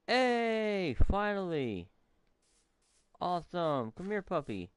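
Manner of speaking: high, thrilled greeting to a found puppy; excited. Real, not acted. Gamer greeting a newly found puppy companion, thrilled and high. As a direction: squeal with delight at the dog. Finds a dog in game.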